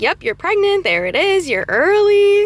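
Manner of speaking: high, singsong imitation of staff; cheery retold confirmation. Clinic staff confirming the pregnancy, imitated in a high cheery voice.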